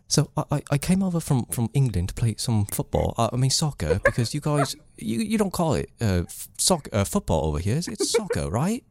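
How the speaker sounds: put-on high-pitched, halting English accent with swooping pitch; David Beckham impression. Guest showing off an old impression to the host, high British accent. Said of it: "an incredible David Beckham impression".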